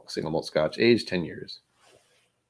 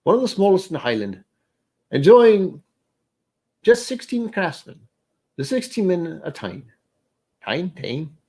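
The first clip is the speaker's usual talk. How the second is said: put-on English accent; 9 semitones higher than usual. Streamer slides from Scottish into a playful English accent. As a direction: drift into a hammed-up English accent mid-read. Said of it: "I'm going English".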